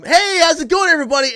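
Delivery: high, loud bright hello; loud cheery greeting. Retold or imitated. Greeting everybody at the start of the shift, high and loud. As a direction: call out a big greeting to the room. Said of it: "I was like".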